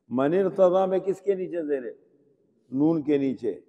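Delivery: ordinary delivery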